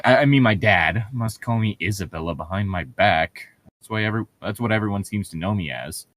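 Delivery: ordinary delivery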